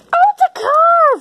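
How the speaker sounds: loud, high burst of delight; excited. Acted. Character finding a toy car, excited. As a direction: exclaim with big excitement.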